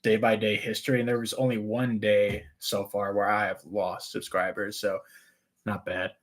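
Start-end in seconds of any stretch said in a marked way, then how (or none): none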